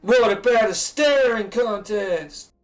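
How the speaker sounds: slow, drawn-out stoner voice; lazy, easygoing, very slow; slow laid-back dude. Acted. Rohan voiced, laid-back suggestion.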